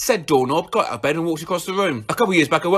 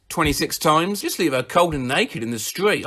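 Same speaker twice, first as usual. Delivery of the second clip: ordinary talk, nothing marked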